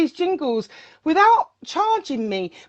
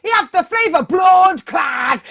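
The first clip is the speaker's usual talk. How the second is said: excited Jamaican patois shout, higher; Jamaican patois accent; 3 semitones higher, 4 dB louder than usual. Retold or imitated. Imitating Jamaican friends praising [name] in patois. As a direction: hype it up like a Jamaican selector. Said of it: "patois accents".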